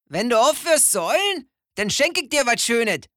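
a know-it-all mole scoffing; mole teasing. A narrator's reading. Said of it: "sagte der Maulwurf".

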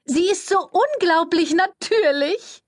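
high, slow sugary publicist voice; gushing flatterer. A narrator's reading. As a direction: lay the flattery on thick and sweet. Cordelia recovering with flattery.

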